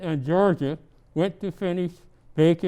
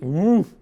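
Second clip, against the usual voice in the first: dog woof; 6 dB louder than usual. Acted. Dog noise.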